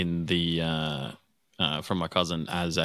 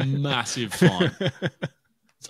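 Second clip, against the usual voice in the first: laughing; 8 semitones higher, 3 dB louder, much slower than usual. Real, not acted. Joking about headline size.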